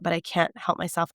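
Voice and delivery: plain reading voice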